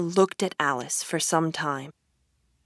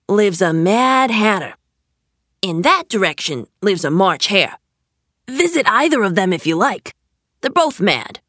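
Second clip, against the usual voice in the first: louder cat character voice, lifting at the end; Cheshire Cat; 2 semitones higher, 6 dB louder than usual. Acted. Cat telling Alice who lives nearby, loud character voice. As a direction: point out the mad neighbours, loudly.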